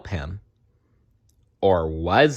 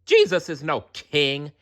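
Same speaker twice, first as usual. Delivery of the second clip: angry yell; 7 semitones higher than usual. Acted. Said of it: "he yelled at the crowd".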